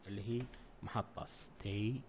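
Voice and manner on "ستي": ordinary talk, nothing marked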